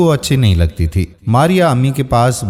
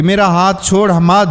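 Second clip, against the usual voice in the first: higher-pitched cry to let go; a narrated yell, pitched up; mock shout; 8 semitones higher than usual. A narrator's reading. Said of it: "ماریا نے چلا کر کہا". (اونچی آواز میں چلاتے ہوئے)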